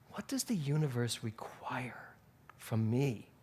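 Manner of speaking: soft whispered question, the word "require" hushed. Shown as a demonstration. The speaker revising her question to the universe, whispering. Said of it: "And I changed it".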